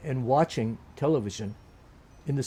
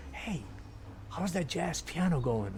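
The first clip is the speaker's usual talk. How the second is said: softened, slightly raised quoting voice; imitated friendly strangers; 3 semitones higher, 8 dB quieter than usual. Tourists stopping a pianist on the street, softly imitated greeting.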